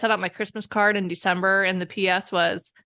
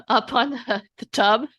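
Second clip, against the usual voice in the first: ordinary talk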